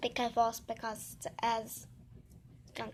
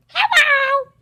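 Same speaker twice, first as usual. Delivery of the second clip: very high, very loud toy greeting; shrieked toy hello; toy's squeaky hello; 16 dB louder than usual. Pretend. Toy greets viewers, shrill cry.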